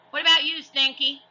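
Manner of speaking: high, loud pirate roar. A narrator's reading. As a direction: roar it at the crew. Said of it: "Captain Bones roared".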